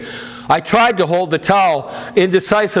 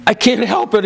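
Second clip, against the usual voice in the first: pained moan; 5 semitones higher than usual. A narrator's reading. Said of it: "he moaned".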